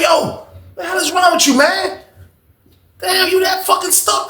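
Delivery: high-pitched retold exasperation; exasperated confrontation. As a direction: confront him, voice way up without extra volume.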